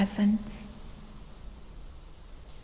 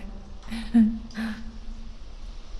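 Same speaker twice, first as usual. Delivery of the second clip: breathy gloating little laugh; sinister chuckle; 3 dB quieter than usual. Acted. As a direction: chuckle darkly over your victim. Gloats over victim's cracked skull.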